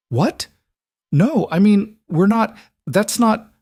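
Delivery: high, rushed, caught off guard; stammering in startled confusion; flustered. A narrator's reading. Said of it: "her mother's flustered response".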